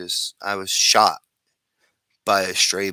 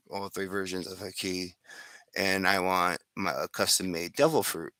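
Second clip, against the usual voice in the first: ordinary delivery, nothing marked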